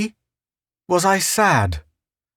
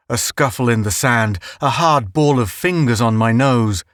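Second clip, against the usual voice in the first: plain reading voice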